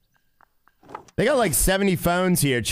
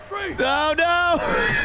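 very high-pitched wail of dismay; dismayed cry; 12 semitones higher than usual. Real, not acted. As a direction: cry out as it all goes wrong. Caught in the game.

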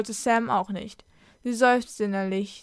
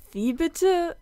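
pitched-up disbelieving question; incredulous; 7 semitones higher than usual. A narrator's reading. Sam asks the talking wolf in disbelief, incredulous. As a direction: ask it higher, in disbelief. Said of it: "fragte Sam unglaublich".

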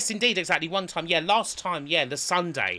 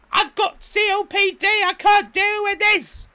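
hammed-up high, loud complaint; imitated shouting; 7 dB louder than usual. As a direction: wail at reception like an outraged guest, drawn out at the end. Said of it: "shouting at the poor receptionist".

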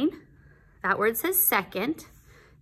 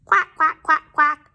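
quacking like little ducklings; high, loud quack-quack chorus; 7 semitones higher, 7 dB louder than usual. Acted. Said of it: "say the ducklings".

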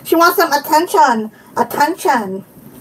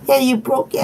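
usual speaking voice

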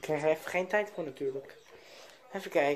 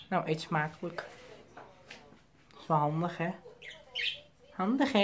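ordinary talk

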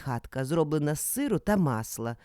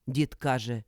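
usual speaking voice

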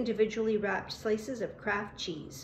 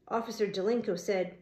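ordinary delivery, nothing marked

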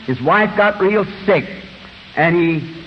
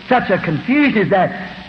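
usual speaking voice

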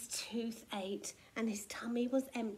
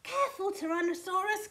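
quick, high warning; alarmed, hurried cry; cried warning; 7 semitones higher, 3 dB louder, faster than usual. A narrator's reading. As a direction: call out urgently. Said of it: "cried Triceratops".

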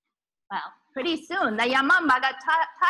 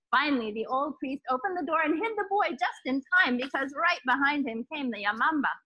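plain reading voice

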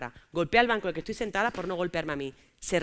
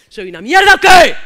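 1 shout; 7 semitones higher, 18 dB louder than usual. Retold or imitated. Her shouting at boyfriend.

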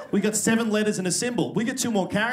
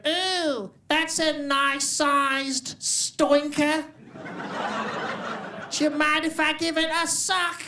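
much higher, slow, broad put-on Stoke accent; 10 semitones higher, much slower than usual. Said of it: "it was the tone. It still scares me today".

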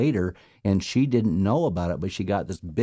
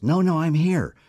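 high, louder no, no; the dead friend insisting to John that he is really there, high protest; insistent protest; 10 semitones higher, 4 dB louder than usual. Retold or imitated. Mock-high insistent reply.

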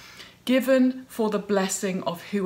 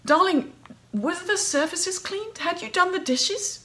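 high, fussy motherly checking; a mother fussing over the housework; mother's fussy voice; 11 semitones higher than usual. Retold or imitated. Her mother questioning Mary about chores, in a high fussy voice. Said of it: "her mother later saying to her".

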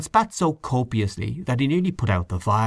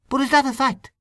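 surprised question. Acted. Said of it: "asked Mister O'Connor".

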